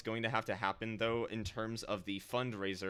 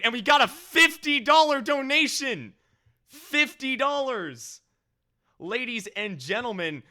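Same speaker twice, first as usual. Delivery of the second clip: much higher, louder, thrilled shouting; overjoyed; 9 semitones higher, 10 dB louder than usual. Real, not acted. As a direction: announce it like a huge win. Announcing big donations.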